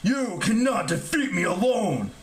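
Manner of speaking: strained, drawn-out villain defiance; groaning giant. A narrator's reading. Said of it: "the giant groaned".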